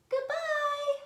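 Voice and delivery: put-on squeaky teary baby bear. Baby Bear voiced in story.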